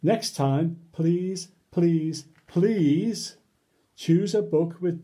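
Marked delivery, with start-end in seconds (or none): none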